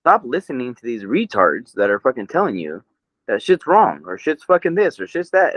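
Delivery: ordinary talk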